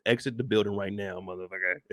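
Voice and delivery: low, soft, fed-up command; low muttered order. Real, not acted. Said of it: "I can't even speak right".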